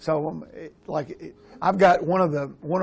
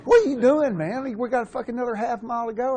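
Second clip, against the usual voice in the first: mocking protesting runner; 8 semitones higher, 3 dB louder than usual. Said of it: "He didn't say that word".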